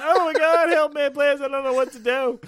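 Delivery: high, loud mock panic. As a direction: ham up a helpless cry for help. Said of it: "running around with a baseball bat going".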